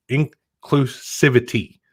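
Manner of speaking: INCLUSIVITY drawn out slowly, part by part; deliberate, careful, syllable-by-syllable "inclusivity". Real, not acted. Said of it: "Let me say that slowly".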